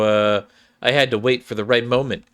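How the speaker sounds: ordinary delivery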